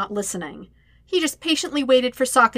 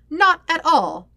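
higher, louder mock denial; sarcastic aside; 7 semitones higher, 5 dB louder, slower than usual. A narrator's reading. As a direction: deny it with heavy sarcasm.

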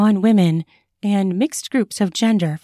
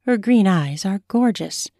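usual speaking voice

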